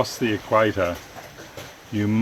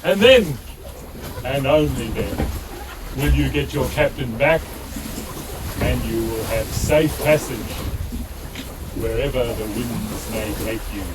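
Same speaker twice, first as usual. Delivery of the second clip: booming warrior king; 3 semitones higher than usual. Acted. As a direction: thunder the demand down from the throne. King gives orders.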